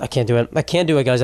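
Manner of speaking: usual speaking voice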